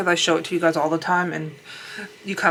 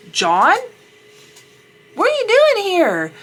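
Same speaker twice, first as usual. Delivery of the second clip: shrill-free high surprised call, louder and much higher; 13 semitones higher, 6 dB louder, slower than usual. Retold or imitated. Sister-in-law spots John with another woman, surprised high call. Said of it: "she's like".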